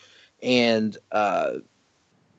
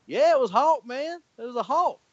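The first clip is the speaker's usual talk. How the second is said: higher, lightly Southern uncle voice, imitated; Southern accent; 13 semitones higher than usual. Uncle confirming it was Hawk, in a light Southern accent. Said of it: "he's got a real thick Southern accent".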